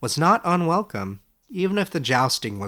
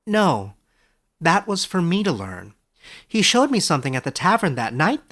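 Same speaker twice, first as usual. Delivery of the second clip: Edric's voice; 6 semitones higher, 4 dB louder than usual. Acted. Young scholar asks his old teacher, amazed and higher. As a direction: ask it wide-eyed, a step higher.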